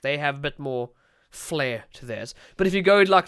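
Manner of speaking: ordinary talk, nothing marked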